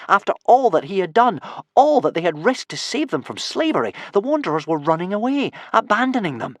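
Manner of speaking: wounded, high-pitched indignation; indignant narration. A narrator's reading. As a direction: tell it with mounting disbelief at the betrayal. Narrator voices Hiccup's disbelief at injustice.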